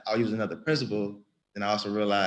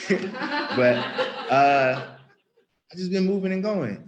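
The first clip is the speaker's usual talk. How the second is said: laughing; 9 semitones higher, 4 dB louder, slower than usual. Real, not acted. Laughs at own joke.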